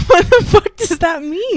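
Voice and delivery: high, loud incredulous outburst; really thrown, voice shooting up; baffled. Real, not acted. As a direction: react in amused disbelief. Baffled reaction to the odd remark.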